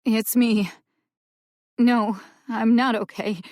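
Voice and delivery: holding back tears on the phone; shaky, teary. A narrator's reading. Upset sister calling for help, shaky and teary. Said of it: "Her voice was shaky, and a tiny sob bled through".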